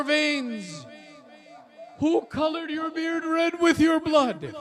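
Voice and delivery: high, loud lament to a slain father; girl crying out over her father's head; grieving cry. A narrator's reading. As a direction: cry out to him, broken with grief. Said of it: "She cries out and she says".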